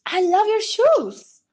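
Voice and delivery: high, slow gushing American voice; mock American compliment. American stranger complimenting her shoes, high gushing mock voice. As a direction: gush over her shoes. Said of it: "Eles vêm e elogiam".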